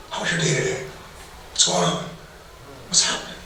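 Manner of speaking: soft, caring check-in; gentle question. Retold or imitated. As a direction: ask it softly, like you care. Gently asking spouse.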